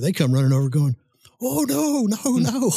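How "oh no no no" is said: alarmed protest. Retold or imitated. Afghans running over, alarmed.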